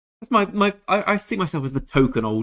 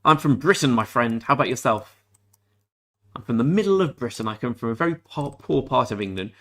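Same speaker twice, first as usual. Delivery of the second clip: normal talking voice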